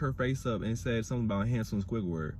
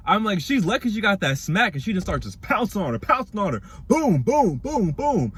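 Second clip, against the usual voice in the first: excited loud retelling; 7 semitones higher, 7 dB louder than usual. Real, not acted.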